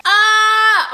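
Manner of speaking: high, loud delighted squeal; excited squeal. Real, not acted. Delighted by a matching skirt, high excited squeal. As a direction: let out a happy shriek.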